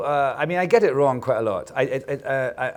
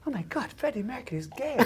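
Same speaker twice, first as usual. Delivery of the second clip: much higher, softer, gasping disbelief imitated; mock shock; 14 semitones higher, 7 dB quieter than usual. Host mocking people shocked by the news, higher. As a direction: parody of the clueless shocked public. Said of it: "people were just like".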